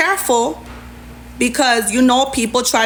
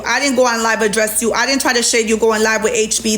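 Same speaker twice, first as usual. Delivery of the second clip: ordinary talk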